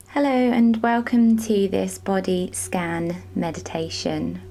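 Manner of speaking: unhurried meditation voice with long pauses; measured, spacious relaxation-guide delivery; guided meditation. Real, not acted. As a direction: guide them gently through a body scan, leaving space between phrases. Leads a body scan meditation.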